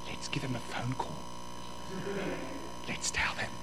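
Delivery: very soft, fading almost to whisper; hushed imitated suggestion. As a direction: imitate a pastor quietly calling a bluff. Pastor calls gossiper's bluff, acted.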